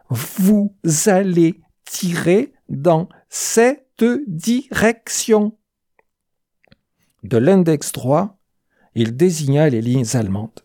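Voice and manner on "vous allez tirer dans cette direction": slow, every syllable of vous allez tirer dans cette direction cut apart; each syllable detached. A narrator's reading. The sergeant orders Vidal, slow with every syllable detached. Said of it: "en détachant chaque syllabe".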